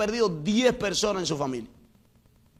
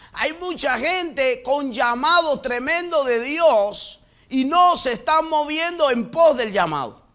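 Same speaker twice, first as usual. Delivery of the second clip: loud, raised preaching voice; fiery preaching; 4 semitones higher, 5 dB louder than usual. Acted. Preacher rebuking those who ignore God's call, loud fervor. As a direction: call the congregation out, full force.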